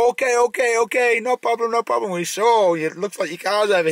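high, hurried, flustered foreign tourist; mock panicky tourist voice; tourist's flustered reply. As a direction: imitate a nervous tourist brushing off help. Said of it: "He's not".

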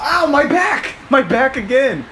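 loud high yelp of pain; hit in the back. Real, not acted. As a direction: yell out as you're struck.

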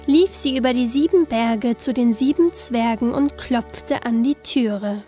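plain reading voice

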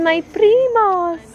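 put-on high, slow little-kid voice; imitating a child. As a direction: copy the child sweetly. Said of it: "She said".

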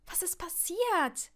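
insistent, high-pitched question; urgent questioning. A narrator's reading. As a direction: press a shaken friend for an answer. Ginny presses shocked Neville for answers.

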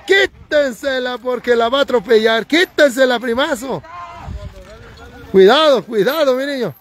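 high, excited trackside warnings; urgent calling out. Real, not acted. Commentator warning riders about to collide, urgent and excited. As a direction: pitch leaps up as you cry out to riders.

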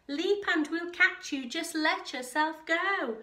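high, loud, coaxing shout; shouted urging. A narrator's reading. Crowd below urging Kevin to leap, shouted. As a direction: urge them loudly to jump. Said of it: "the crowd shouted".

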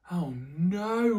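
loud, high frustrated "oh no"; exasperated groan. Acted. Mister Bear woken by snoring, loud exasperated cry. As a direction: cry out at yet another noise.

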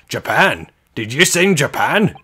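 loud, high, incredulous Scottish governor; attempted Scottish accent. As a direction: exclaim in disbelief with a rough Scottish accent. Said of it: "I feel like he's Scottish".

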